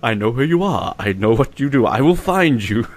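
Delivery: low, menacing movie-hero voice; mock threat. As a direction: play the avenger hunting a kidnapper. Playing a threatening movie avenger.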